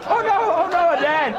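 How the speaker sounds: hammed-up falsetto of a scandalised prude; mock coy refusal. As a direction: ham up a shocked prim refusal.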